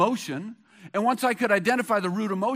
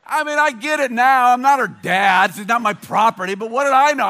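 loud self-mocking; 4 semitones higher, 9 dB louder than usual. Real, not acted. Pastor laughs at himself, louder.

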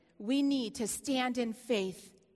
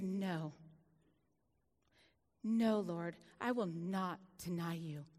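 low, soft surrender; soft tearful reply; 7 semitones lower, 5 dB quieter, slower than usual. Retold or imitated. Preacher recalling her answer to God, soft and tearful. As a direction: answer God quietly, broken.

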